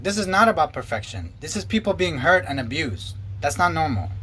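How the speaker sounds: ordinary talk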